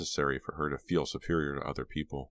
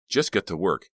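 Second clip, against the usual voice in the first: raised-voice angry command; angry order; 4 semitones higher, 5 dB louder than usual. A narrator's reading. Rawson turns to Rob after the fight, angry. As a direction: order him crossly back to work. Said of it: "Rawson said angrily".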